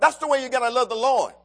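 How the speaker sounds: ordinary talk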